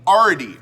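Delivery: "already" squashed into "ardy", loud; mushed-up word. Real, not acted. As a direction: repeat "already" loudly without its l. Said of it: "when I mush up words".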